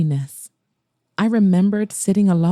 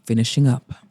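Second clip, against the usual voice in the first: Mason, simply; 7 semitones lower than usual. Acted. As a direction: lower male voice, curt and simple. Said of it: "he said simply".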